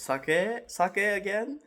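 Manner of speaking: high, loud hibachi chef call. Retold or imitated. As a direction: call it out loud and bright to the table. Hibachi chef calling out offer.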